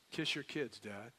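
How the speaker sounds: ordinary talk, nothing marked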